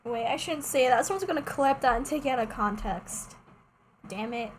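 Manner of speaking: ordinary talk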